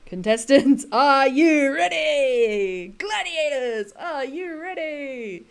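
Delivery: big drawn-out arena call, starting loud; hammed-up announcer rallying the contestants; TV game show announcer. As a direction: stretch each word into a long ringing call. Imitating the Gladiators show announcer's call.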